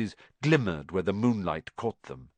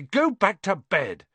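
higher-pitched angry order; furious; 8 semitones higher, 4 dB louder than usual. A narrator's reading. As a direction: order them off crossly. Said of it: "said Ron furiously".

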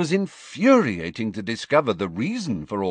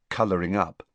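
usual speaking voice

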